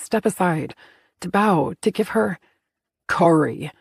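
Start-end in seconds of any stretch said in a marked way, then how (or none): 3.1-3.7 growled. A narrator's reading. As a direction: growl her name in warning. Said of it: "Sven growled".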